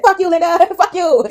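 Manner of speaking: high-pitched mock yell, breaking into a laugh; retold taunt. Man taunts Linda from his raft, high mock yell.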